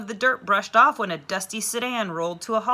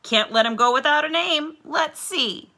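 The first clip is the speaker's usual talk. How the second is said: higher, louder teacher voice thinking aloud; playful teacher; 9 semitones higher, 6 dB louder than usual. A narrator's reading. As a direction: muse on a name merrily. Teacher musing over a name.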